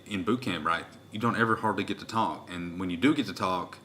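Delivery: usual speaking voice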